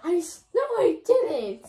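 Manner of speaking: loud denial. Real, not acted. Denying sleeping with Frank.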